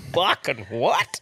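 slow, laughing fucking what; laughing disbelief. Retold or imitated. Bus passengers reacting to the chook answer, slow laughing disbelief. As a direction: stretch it out through a laugh. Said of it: "everyone's like".

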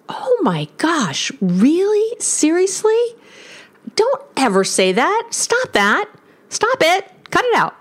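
high-pitched incredulous protest, really, seriously; exasperated disbelief. Real, not acted. Therapist to couples, appalled by don't take this the wrong way. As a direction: throw up your hands at a terrible phrase. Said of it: "exasperated outburst at the phrase".

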